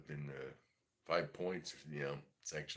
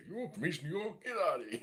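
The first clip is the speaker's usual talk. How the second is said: much higher, disbelieving "you're from East New York?"; mock shock, pitched way up; incredulous; 13 semitones higher, 6 dB louder than usual. Imitating people who doubt he grew up there, incredulous.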